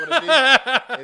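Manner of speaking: laughing. Real, not acted. Laughing at his mistake.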